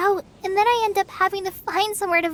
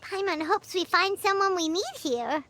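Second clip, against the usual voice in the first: put-on childlike companion voice; 4 semitones higher, 4 dB quieter than usual. Paimon character voice.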